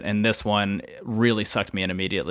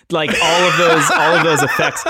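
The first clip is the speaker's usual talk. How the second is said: loud laughter through the words; laughing; 4 semitones higher, 5 dB louder than usual. Real, not acted. Laughing at funny game effects.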